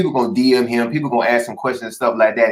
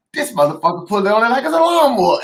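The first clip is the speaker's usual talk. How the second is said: high, laughing quote of the commenter; mock commenter; 9 semitones higher than usual. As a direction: squeal it out while cracking up. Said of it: "He was like".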